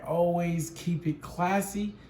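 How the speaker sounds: slow, drawn-out catchphrase; slow sign-off. Acted. Signing off to viewers, slow catchphrase. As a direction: savour the catchphrase slowly.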